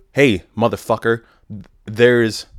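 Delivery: hammed-up demand to an employee; blunt mock order. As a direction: bark it like a blunt boss. Acting out a blunt manager's order.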